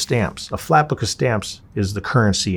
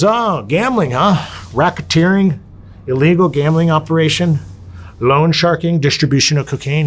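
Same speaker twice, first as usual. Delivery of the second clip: loud, pitched-up sarcastic roll call of charges; mocking officer; 7 semitones higher, 5 dB louder than usual. Officer reading out the inmate's charges, loud and mocking. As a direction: rattle off the crimes like a smug guard.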